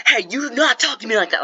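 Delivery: high, loud mock outrage; angry outburst. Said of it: "he gets all angry".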